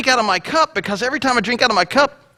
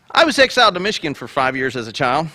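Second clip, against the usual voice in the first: normal talking voice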